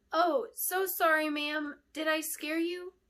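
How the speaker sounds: put-on high, eager child's voice; a small apprentice girl, bright and apologetic; little girl voice. Little apprentice surprising the queen, high child voice.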